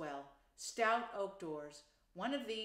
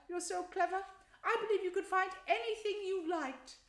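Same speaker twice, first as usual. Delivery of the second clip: high, loud, gushing Mole voice; pitched right up, loud and exclaiming; excited storybook Mole; 8 semitones higher, 6 dB louder than usual. Acted. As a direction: play a small animal gushing with admiration.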